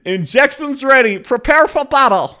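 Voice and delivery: echoing the game's so-called Aussie voice line; a light imitation of a fake Aussie accent; mock Aussie accent. Streamer mocking the character's Aussie voice line, lightly voiced. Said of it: "How is that Aussie?".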